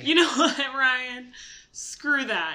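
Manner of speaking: loud, playful outburst; half-joking, loud dismissal; playful shout. Real, not acted. Mock outburst at co-host.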